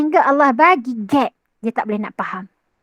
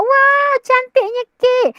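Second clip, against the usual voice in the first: much higher, slow amazed wow; delighted surprise; 11 semitones higher, slower than usual. Acted. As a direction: marvel at the cake suddenly seen. Lights on, seeing cake.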